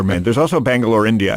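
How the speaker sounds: usual speaking voice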